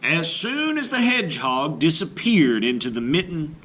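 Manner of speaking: plain reading voice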